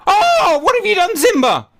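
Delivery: high, dismayed cry; shocked. Real, not acted. Commander shocked at teammate Simba, high dismay. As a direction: disbelief at the loss. Own carrier gets hit.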